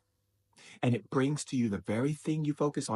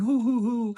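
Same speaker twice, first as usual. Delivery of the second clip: high, loud mock sob hoo hoo hoo; hammed-up fake sobbing sound; the darkness voice mocking your fear with a high sob; 12 semitones higher, 6 dB louder than usual. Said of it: "darkness tells you".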